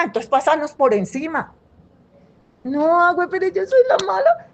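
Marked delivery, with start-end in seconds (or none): 2.6-4.5 high, fast mock whimper of a sulking girl; whiny daughter. Daughter protesting to her mother, in a high mock whimper. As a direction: play the hard-done-by kid.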